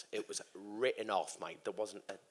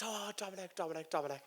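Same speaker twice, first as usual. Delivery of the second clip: higher, softer put-on woman's voice; imitated fussing girlfriend; 8 semitones higher, 6 dB quieter than usual. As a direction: repeat the name in high, mock concern. Girlfriend rushing in, voiced in story.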